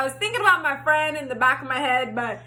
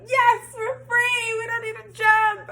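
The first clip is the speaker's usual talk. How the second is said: elated relief; 10 semitones higher, slower than usual. Retold or imitated. Relief at being free.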